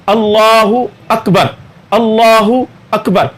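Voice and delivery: slow अल्लाहु अकबर, the hamza kept; correct pronunciation. Shown as a demonstration. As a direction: imam shows students the right takbir slowly. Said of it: "इसको दुरुस्त करें".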